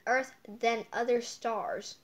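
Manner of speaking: usual speaking voice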